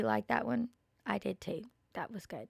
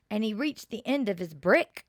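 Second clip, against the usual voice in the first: plain reading voice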